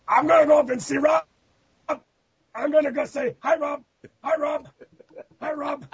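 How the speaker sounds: high-pitched mock Butch voice; goofy high impression of an excited fan; Butch in rare form. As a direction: squeal it eagerly, imitating the man. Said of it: "was in rare form. He's like".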